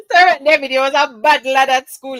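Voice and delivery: laughing, high and loud teasing; laughing tease. Real, not acted. To Servant David in chat, laughing tease. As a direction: giggle through the teasing.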